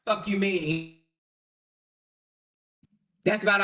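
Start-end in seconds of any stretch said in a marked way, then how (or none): none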